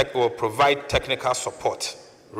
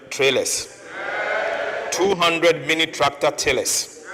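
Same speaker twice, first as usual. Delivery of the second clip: raised, emphatic list, each item announced separately; emphatic roll call; 4 semitones higher, 3 dB louder than usual. Real, not acted. Minister announcing farm machinery to cheering parliament, loud and emphatic.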